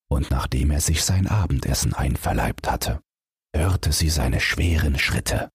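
ordinary delivery, nothing marked

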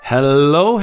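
normal talking voice

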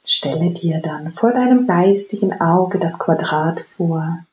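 slow, calm meditation voice; guided meditation. Acted. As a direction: guide them gently into stillness. Leads a guided breathing meditation.

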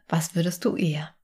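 ordinary delivery

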